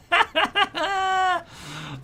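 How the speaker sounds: loud high burst of laughter; big laugh. Real, not acted. Gamer laughing hard at his slingers' volley, loud and high. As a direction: let a real delighted laugh explode.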